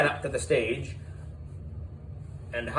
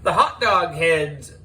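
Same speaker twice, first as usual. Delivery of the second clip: taunting shout; 6 semitones higher, 5 dB louder, slower than usual. A narrator's reading. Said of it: "shouted Miss Holly".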